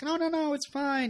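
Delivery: high-pitched mock "no worries" voice; imitated breezy reassurance. Rory brushing off his avoiding school talk, imitated breezy reassurance. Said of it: "and she's like".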